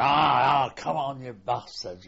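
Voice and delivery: loud drawn-out battle yell, tapering off; retold charging shout. Veteran acts out shouting while rushing forward, loud yell. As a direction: re-enact the yell, then ease toward normal volume. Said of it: "Oh, we shouted".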